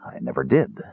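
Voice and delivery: low, soft murmur of wonder. A narrator's reading. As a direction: say it under your breath, amazed. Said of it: "he murmured".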